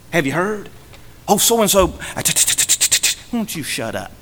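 a gossiping churchgoer, stammering into a hushed aside; mock gossip. Preacher mocking a gossip to his congregation, hushed and stammering. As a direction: ape the eager gossip who can't get it out.

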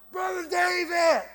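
loud, high holler across a field; drawn-out shout, hollering for attention. Retold or imitated. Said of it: "he's hollering".

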